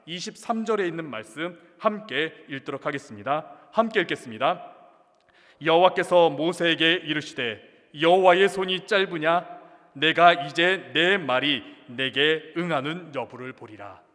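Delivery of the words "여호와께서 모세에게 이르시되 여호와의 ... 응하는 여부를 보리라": slow, loud unison-style Bible reading; solemn, drawn-out recitation at full volume; scripture read-aloud. Real, not acted. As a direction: lead the congregation through the verse, measured and loud. Leads congregation in reading scripture.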